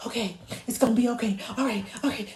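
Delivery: rushed, jittery fake reassurance; hurried nervous babble; anxious. Shown as a demonstration. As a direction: act out a flustered caregiver. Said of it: "they can tell that you're anxious".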